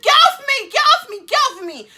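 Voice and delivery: high, loud, repeated shriek; frantic acted-out shouting; re-enacted panicked yell. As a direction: yell it in bursts to push someone away. Acting out her panicked refusal.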